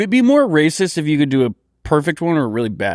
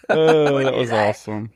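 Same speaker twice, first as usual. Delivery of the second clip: normal talking voice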